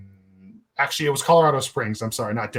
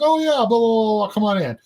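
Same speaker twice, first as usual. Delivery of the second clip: much higher, louder, easygoing greeting; breezy tattoo guy; 9 semitones higher, 5 dB louder than usual. Retold or imitated. Tattoo artist letting the band in, a high breezy greeting. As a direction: play the tattoo artist waving them in casually.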